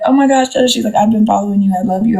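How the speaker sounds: high-pitched fangirl gush; imitated excited fan. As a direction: gush like a fan meeting their idol. Imitates a fan recognizing her.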